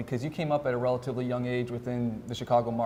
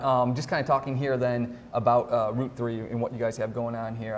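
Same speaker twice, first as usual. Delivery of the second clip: normal talking voice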